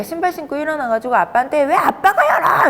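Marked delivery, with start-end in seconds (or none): 1.6-2.7 put-on high, loud child's protest; 아이의 고함. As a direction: yell it like an indignant kid, acted out. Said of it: "이렇게 소리를 지르는 거예요". (아이처럼 높게 소리 지르며)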